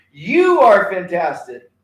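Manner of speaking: loud, slow chant, pointing at a partner; rally call. Shown as a demonstration. Pupils telling a partner, loud and slow. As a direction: punch it out slowly at your partner. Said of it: "point to them and say".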